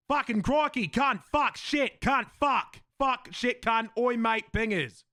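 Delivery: loud, high, hammed-up Australian swearing; exaggerated Aussie accent. Shown as a demonstration. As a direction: fire off Aussie slang like a warm-up drill. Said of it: "go back to me Aussie, me Aussie accent".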